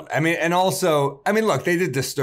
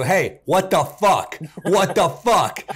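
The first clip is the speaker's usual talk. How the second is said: retold angry call; 5 semitones higher, slower than usual. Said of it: "I'll go".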